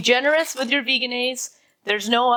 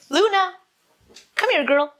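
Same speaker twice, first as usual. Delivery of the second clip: calling the dog; 8 semitones higher, 3 dB louder than usual. Real, not acted. Calling the puppy over.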